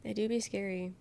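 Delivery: ordinary talk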